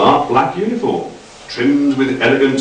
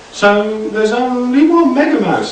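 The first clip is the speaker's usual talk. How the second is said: much higher, fussy correction; squeaky Doc; 12 semitones higher than usual. A narrator's reading. Doc correcting Frank's grammar, high fussy voice. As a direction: pipe up high and prissy.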